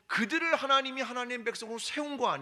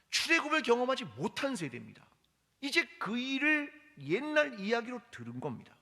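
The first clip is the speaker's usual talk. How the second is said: ordinary talk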